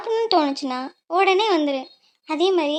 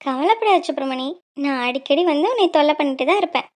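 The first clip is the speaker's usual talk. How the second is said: usual speaking voice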